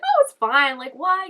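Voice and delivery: high, loud, upbeat brush-off; imitated breezy optimism. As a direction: imitate a cheerful optimist shrugging it off. Acting out his cheerful optimism.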